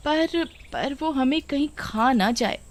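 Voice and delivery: high, slow, hesitant worry; fearful. Acted. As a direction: falter nervously, afraid of being eaten. Woman fears witch will eat her. (डरते हुए, हिचकिचाकर)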